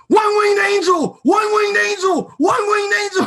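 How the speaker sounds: high, full-force repeated shout; Don Callis yelling. Retold or imitated. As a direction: yell it at him over and over, loud and high. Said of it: "he's just yelling at him".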